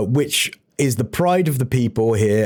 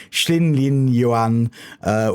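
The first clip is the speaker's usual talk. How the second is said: usual speaking voice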